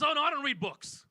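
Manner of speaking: hammed-up older guy voice; older man. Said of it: "late fifties, early sixties".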